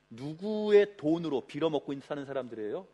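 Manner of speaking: normal talking voice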